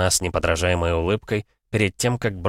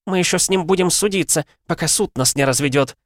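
high, loud bitter threat; a wife threatening court, high and angry; 12 semitones higher, 3 dB louder than usual. A narrator's reading. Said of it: "зло произнесла Лариса".

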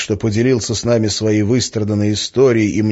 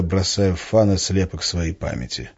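usual speaking voice

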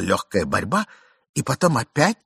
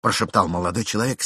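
plain reading voice

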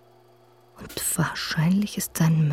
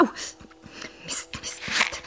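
hissed curses under the breath; frightened whispered swearing; 6 dB quieter, much slower than usual. Acted. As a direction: swear without being heard. Hurt in dark, cursing quietly.

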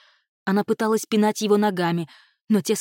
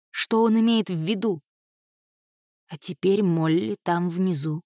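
ordinary delivery

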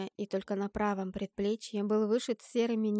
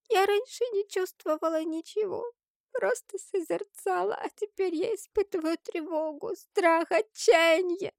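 put-on high, tearful girl's voice building louder; distressed child; 10 semitones higher, 5 dB louder than usual. A narrator's reading. Crying girl confessing new fear and despair, high and distressed. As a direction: plead in a high voice, dragging out the last word.

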